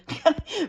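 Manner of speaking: short, high re-enacted laugh; brief mock laugh before a comeback; scoffing laugh. Traveler answering the woman at the gate, acted scoffing laugh. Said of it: "and I laughed".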